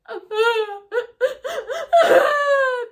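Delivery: loud, high hammed-up sob; mock wailing cry. Imitating her crying at the ceremony, a loud high wail. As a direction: throw in a big fake wail.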